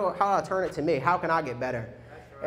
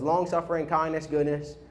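ordinary delivery

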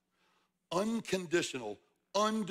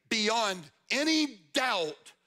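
BEYOND ANY DOUBT drawn out very slowly; emphatic; 3 semitones higher, much slower than usual. Acted. Preacher voicing the psalmist's certainty, emphatic. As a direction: stretch ANY DOUBT like an exclamation point. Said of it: "exclamation point".

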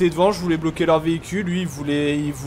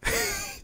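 sudden squeaky giggle, very high; a real laugh that jumps way up; high burst of laughter; 11 dB quieter than usual. Real, not acted. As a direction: break into a shrill little laugh. Streamer cracks up at mix-up.

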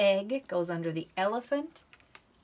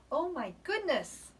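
mock surprise; 6 semitones higher, 4 dB louder than usual. Excited discovery.

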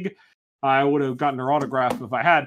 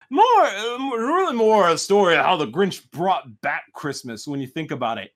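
plain reading voice

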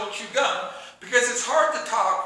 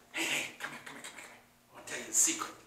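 whispered, secretive creepy come-on; very quiet, low, hushed; creepy weirdo; 11 semitones lower, 13 dB quieter, much faster than usual. Acted. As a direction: whisper it like a weirdo luring someone over. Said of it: "don't be weird. And by what that he means".

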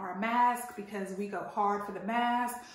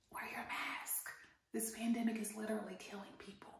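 soft, breathy whisper; a hushed urgent plea; 13 dB quieter than usual. Shown as a demonstration. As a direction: lean in and whisper the warning. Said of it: "repeated plea, whispered".

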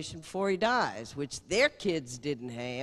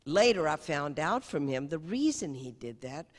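usual speaking voice

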